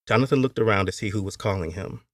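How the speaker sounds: ordinary delivery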